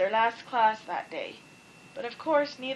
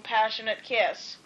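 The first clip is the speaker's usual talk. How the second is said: ordinary delivery, nothing marked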